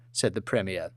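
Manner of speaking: plain reading voice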